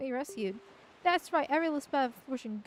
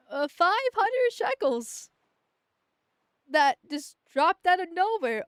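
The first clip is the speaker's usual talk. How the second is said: bewildered; 3 semitones higher, 3 dB louder, slower than usual. Real, not acted. Surprise money drop in game.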